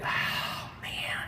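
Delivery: breathy unvoiced mock dismay; whispered groan. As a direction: sigh out a reluctant oh man. Reluctant answer to boss.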